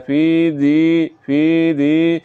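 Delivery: فی دی slowly, the ee drawn out; drawn-out vowel, recitation demo; long ee. Shown as a demonstration. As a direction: draw out دی in فی دی. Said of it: "تو اس کو لمبا کرنا ہے".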